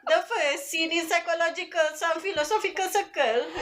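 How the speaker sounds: Kelantanese English read high and laughing; Kelantanese accent. Pretend. Reading text as a Kelantanese person speaking English, high and laughing. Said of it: "a Kelantanese person speaking English".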